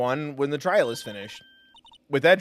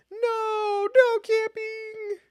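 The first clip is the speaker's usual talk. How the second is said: much higher, slow squeaky voice reading the sign; silly high sign voice; much slower than usual. Acted. Reading a camping sign aloud, squeaky and slow. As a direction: read the sign like a squeaky cartoon.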